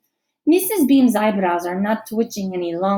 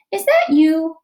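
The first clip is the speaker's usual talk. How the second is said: higher, louder asking voice; a hopeful girl asking outright; Selina asking; 7 semitones higher, 6 dB louder than usual. A narrator's reading. Girl asks classmate, louder and higher. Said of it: "she asks Lucy".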